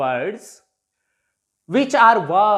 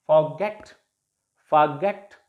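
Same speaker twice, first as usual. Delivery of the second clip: "forget" with short for-, stressed GET; forGET, low and measured; stress on last syllable; 6 semitones lower than usual. Shown as a demonstration. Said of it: "stress will be on the last syllable".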